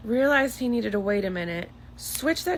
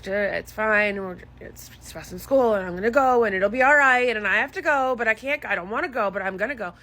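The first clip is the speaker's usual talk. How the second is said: imitating son talking himself down; 5 dB louder than usual. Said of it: "but then stops and says".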